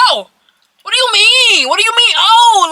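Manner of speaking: high, loud, incredulous challenge; indignant confrontation. Retold or imitated. Acted quarrel with boyfriend.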